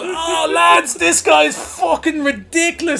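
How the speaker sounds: mocking outburst. Boy mocking Bill to the lads, high derisive outburst. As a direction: hoot at how useless he is.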